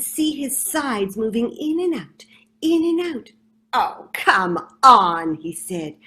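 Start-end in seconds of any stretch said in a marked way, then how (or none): 3.7-5.4 scornful rat. Acted.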